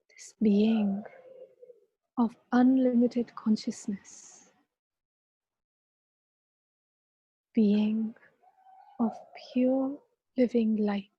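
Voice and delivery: calm guiding voice with long pauses; guided meditation. Acted. Leads a meditation.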